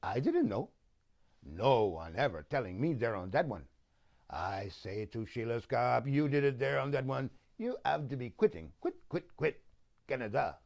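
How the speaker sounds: put-on thick Quebec French-Canadian accent, broken English; Jean Chrétien imitation. Prime minister blaming his minister, mock Quebec French-Canadian accent. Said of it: "He would say things like this".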